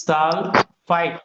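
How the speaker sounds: Sulphite stretched out very slowly; slow dictation. Shown as a demonstration. Teacher drilling students on the name, slowly stretched. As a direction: draw out each syllable of sulphite.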